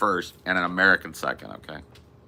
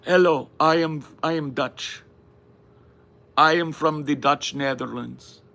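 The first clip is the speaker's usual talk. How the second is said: put-on high, odd Dutch accent, vaguely German; hammed-up Dutch accent offering tourists mushrooms; mock Dutch accent; 6 semitones higher than usual. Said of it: "Now, Dutch".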